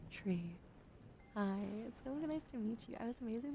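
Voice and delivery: ordinary delivery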